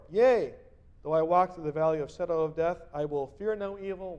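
loud proclaimed psalm; scripture reading. Acted. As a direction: read it out as a promise. Preacher reads psalm aloud.

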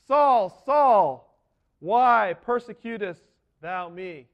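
booming call from above, slow on the last words; a divine voice calling him out; voice from heaven. Acted. Voice of Jesus calls out to Saul on the road, booming. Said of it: "and I heard a voice".

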